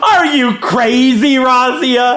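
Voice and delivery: high, loud, offended crowing; hammed-up "how dare you" outrage; mock indignation. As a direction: throw the insult back, stretching the words. Gloats back at the insult.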